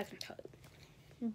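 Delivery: slow, secretive whisper. Acted. Vlogger confides to her friends, a slow secretive whisper. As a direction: lean in and whisper it slowly.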